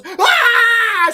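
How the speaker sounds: sudden high shriek, loud and long; mock scream. Mother handed her dead child, shrieking. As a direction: shriek bloody murder like a startled maniac. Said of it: "She fucking screams".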